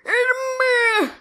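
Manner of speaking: high, slow Scottish cry of Edinburgh; Scottish accent. Shown as a demonstration. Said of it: "do your Edinburgh thing".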